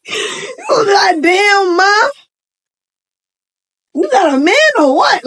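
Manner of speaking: high-pitched, drawn-out flirty hype. Acted. Husband flirting with his wife, high hyped voice. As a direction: come on to her in a high, hyped voice.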